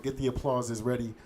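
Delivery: usual speaking voice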